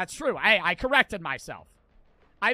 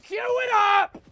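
hyped; 9 semitones higher, 6 dB louder than usual. Real, not acted. Hyped start of ranked game.

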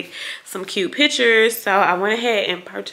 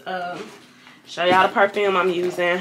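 ordinary talk, nothing marked

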